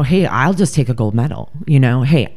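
normal talking voice

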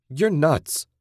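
high, sharp narrated outburst; a contained cry, pitched well up; restrained scream. A narrator's reading. Captive Susan to her captor, restrained scream. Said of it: "Susan screamed".

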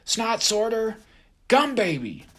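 high, loud rallying yell; battle cry. A narrator's reading. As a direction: cheer herself on like a brawler. Gum Baby battle cry.

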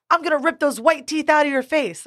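high-pitched imitation of a threat; mock threat. Drunk girl threatening her in retold quarrel, high mock threat.

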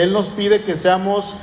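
usual speaking voice

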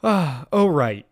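usual speaking voice

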